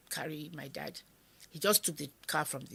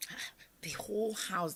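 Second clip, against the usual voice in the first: ordinary delivery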